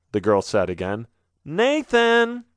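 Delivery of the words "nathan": high insistent call. A narrator's reading. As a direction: call his name to wake him. Voice calls him, waking him.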